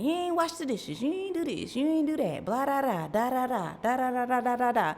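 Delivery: singsong retold scolding; mock spazzing. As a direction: imitate yourself going off, trailing into blah-blahs. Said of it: "I was spazzing".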